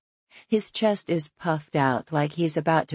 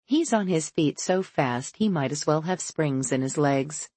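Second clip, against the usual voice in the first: plain reading voice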